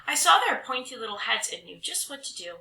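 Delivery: ordinary delivery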